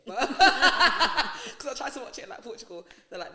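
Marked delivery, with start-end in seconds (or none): 0.2-1.5 high loud burst of laughter. Real, not acted. Laughing about EastEnders abroad.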